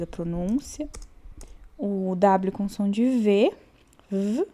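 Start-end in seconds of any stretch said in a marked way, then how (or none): none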